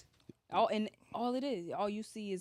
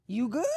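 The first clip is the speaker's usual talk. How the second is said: YOU GOOD louder with a rising question; offering a smoke; 4 semitones higher, 8 dB louder than usual. Acted. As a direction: toss out "You good?" as an invitation, pitched up. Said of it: "I got some tree".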